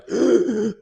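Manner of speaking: high, loud imitated crying gasp; breathless sobbing gasp. Shown as a demonstration. Showing how hard the crying was, breathless sobbing gasp. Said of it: "can't even breathe crying".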